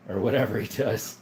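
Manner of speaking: ordinary talk, nothing marked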